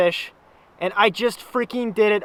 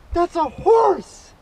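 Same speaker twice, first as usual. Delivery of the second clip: higher, louder, drawn out in awe; thrilled shout of wonder; excited exclaim; 8 semitones higher, 4 dB louder, slower than usual. Real, not acted. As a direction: proclaim the catch with glee. Thrilled by personal best fish.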